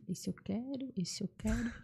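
soft, eager little repeat; hushed picking-out. Retold or imitated. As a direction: murmur it as if secretly choosing. Mimes picking out former students.

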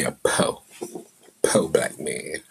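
"po" with no r, slow and drawn out. Shown as a demonstration. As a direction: lead them: "po", slow. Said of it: "You gotta say with me".

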